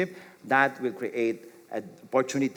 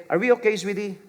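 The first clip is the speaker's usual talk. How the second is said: spouse checking in; 6 semitones higher, 4 dB louder, faster than usual. Retold or imitated. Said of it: "Your spouse would say".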